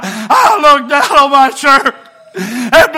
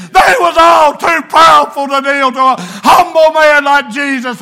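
loud, slowed, crying preaching; preaching at full force; 4 semitones higher, 3 dB louder than usual. Acted. Preacher warning the congregation about pride, full-force preaching. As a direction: warn them off pride with everything.

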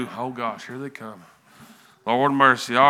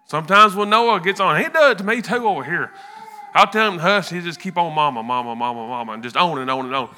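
ordinary delivery

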